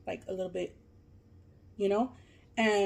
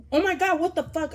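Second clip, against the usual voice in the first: high, loud mock-shocked exclamation; shocked outburst; 9 semitones higher, 6 dB louder than usual. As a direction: act out an over-the-top freak-out. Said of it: "my reaction wasn't like".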